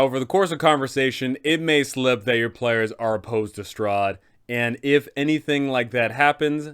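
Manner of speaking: ordinary talk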